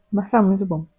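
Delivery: talking with a mouth full of food; chewing while saying it's delicious. Real, not acted. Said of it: "Falando de boca cheia". (falando de boca cheia)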